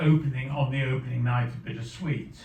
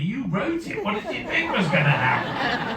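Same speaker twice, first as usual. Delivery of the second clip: high-pitched retort, imitating an incredulous listener; mock exasperation; 8 semitones higher, 5 dB louder than usual. Wife to writer crying at his own scene, mock exasperation. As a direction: throw it back as exasperated disbelief, played for laughs.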